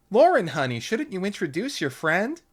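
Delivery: high, loud sweet mother voice; a cheery mom calling her daughter; high sugary mom voice; Lauren's mother. A narrator's reading.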